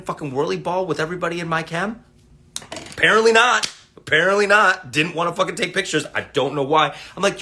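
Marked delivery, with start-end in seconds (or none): 2.7-4.9 sarcastic emphasis. Real, not acted. Mock answer to own question.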